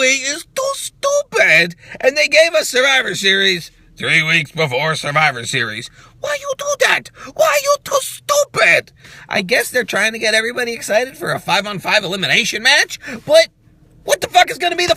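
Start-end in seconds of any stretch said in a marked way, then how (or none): 6.2-8.9 very high squeaky complaint; mock exasperated whine. Vlogger mocking WWE's booking choice in a high squeak. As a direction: whine up high like a baffled kid.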